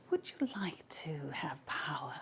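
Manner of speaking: whispered, voiceless offer of power; tempting whisper. Acted. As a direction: breathe it into his mind like a temptress. Hera tempting in his head.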